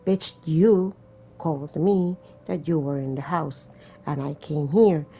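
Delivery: ordinary talk, nothing marked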